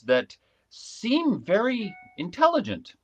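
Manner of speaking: seem lifted high and drawn out; stress on seem. Shown as a demonstration. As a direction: lean hard on the word seem, sceptical. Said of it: "And I emphasize the word seem".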